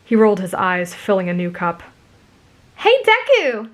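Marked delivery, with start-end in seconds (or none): 2.8-3.7 very high, piercing bubbly greeting. A narrator's reading. Girl hails her friend through the crowd, bubbly. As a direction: call brightly across a crowd. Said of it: "a bubbly voice pierced through the crowd".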